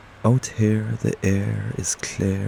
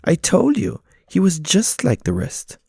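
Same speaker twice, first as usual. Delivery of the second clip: very high, louder cat voice, smug; 12 semitones higher, 5 dB louder than usual. Acted. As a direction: gloat over the body. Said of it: "one of the cats says".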